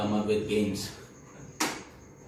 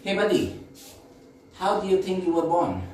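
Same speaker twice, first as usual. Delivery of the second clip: higher, quick questioning with authority; mock authority; 6 semitones higher, faster than usual. Friend quizzing him on birth, mock authority. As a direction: quiz him like a know-it-all friend. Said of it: "with a lot of authority".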